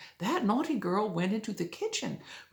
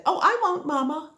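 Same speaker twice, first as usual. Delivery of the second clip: put-on high, loud little girl voice; sweet innocent girl promising; 9 semitones higher, 4 dB louder than usual. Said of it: "Goldilocks said".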